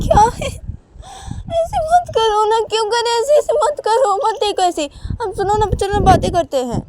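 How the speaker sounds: very high, loud wail; pleading Uma. Acted. Uma begging Sanju to talk instead, shrieky pleading. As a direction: beg at the top of the voice.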